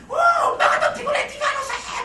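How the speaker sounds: 아주 높고 크게 외치는 엄마; 짜증 폭발한 듯 높은 고함; emotional mom shouting. Retold or imitated. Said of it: "막 이러시고". (기분파 엄마가 아이에게 높은 목소리로 고함)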